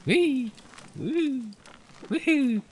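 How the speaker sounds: high gleeful whoops while bouncing; bouncy giddy cheers; playful whee. Real, not acted. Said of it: "Oh, bouncy couch".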